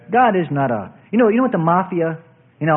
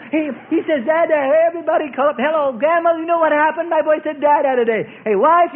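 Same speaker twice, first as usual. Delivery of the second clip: high, excited proud father; excited proud dad; 9 semitones higher than usual. Acted. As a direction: gush like a thrilled new dad. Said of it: "So excited".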